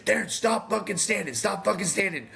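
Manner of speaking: high, urgent yell from the corner; coach screaming. Retold or imitated. Coach screaming at his fighter to stop standing, high yell. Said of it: "I hear Vince screaming".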